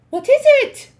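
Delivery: high loud witches crying out. Acted. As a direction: call out in alarm. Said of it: "the others cried out".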